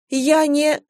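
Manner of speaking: high, meek, cut short; helpless high-pitched false start; timid protest. A narrator's reading. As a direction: try to object in a small high voice. Wife cut off mid-protest.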